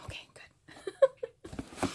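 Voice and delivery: slow high whisper of relief. Real, not acted. Checking the camera, whispering quiet relief. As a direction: breathe it out in a soft whisper.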